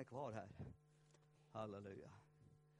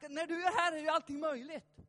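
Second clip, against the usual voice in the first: loud and high, full-voiced praise; jubilant shout; 11 semitones higher, 11 dB louder than usual. Real, not acted. Preacher proclaiming that all is possible.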